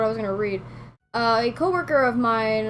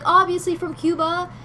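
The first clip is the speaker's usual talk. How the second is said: high-pitched imitation of a know-it-all parent; mock someone stating the obvious, pitched up; lightly acted parental teasing; 7 semitones higher, 4 dB louder than usual. Parents teasing, voiced in story.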